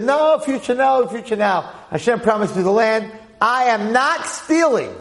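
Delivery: high, loud, slow indignant denial; insistent protest. Retold or imitated. Lot protesting to Avram that he is not stealing, high and loud. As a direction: refuse to back down, pitching it up high.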